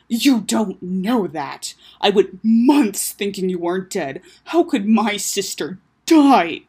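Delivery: loud, slow, emphatic outburst; upset protest. Acted. Taco insisting his sister could not die, upset. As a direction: protest hard, dragging out each stress. Brother upset, denies believing her dead.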